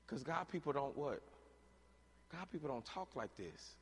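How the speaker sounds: usual speaking voice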